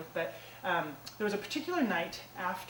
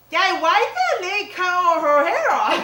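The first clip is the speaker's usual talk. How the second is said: put-on high, louder, slow child's question; little girl voice; 10 semitones higher, 12 dB louder than usual. Little girl asking her dad at the salon, high child voice. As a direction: ask like a puzzled three-year-old. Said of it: "you hear the little girl go".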